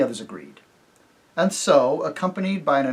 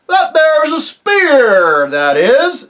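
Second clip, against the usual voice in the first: high, loud, hearty rustic voice with a chuckle; jovial rustic chief, laughing as he talks; chief of the invisible folk; 13 semitones higher, 12 dB louder, much slower than usual. Acted. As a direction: boom it out like a jolly simple village chief. Chief voice boasts.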